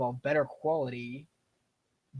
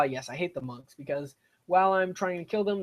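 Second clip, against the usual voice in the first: ordinary delivery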